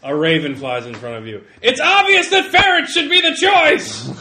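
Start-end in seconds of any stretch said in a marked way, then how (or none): 1.6-4.1 high, loud outraged yell; screaming heckler. Acted. Voice in a crowd arguing about ferrets, screamed. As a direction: yell it across a rowdy crowd. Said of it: "Someone screams".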